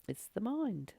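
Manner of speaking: usual speaking voice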